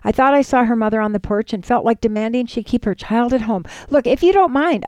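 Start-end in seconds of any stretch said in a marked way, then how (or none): 3.9-4.8 mildly cross, raised pitch; understated crossness on a higher note. A narrator's reading. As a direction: put the child off with some irritation. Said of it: "I said crossly".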